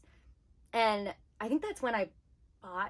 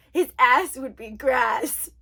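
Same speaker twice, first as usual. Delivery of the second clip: loud, slow, exaggerated delivery; mocking read-aloud; 9 dB louder, slower than usual. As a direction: hammer out the slang with ridicule. Mocking the new translation's slang.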